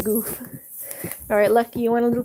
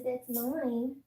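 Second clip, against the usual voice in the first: softer possessive dog voice; voicing the dog; 8 dB quieter than usual. Owner voicing her dog claiming food, softer. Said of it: "Mama Looney says".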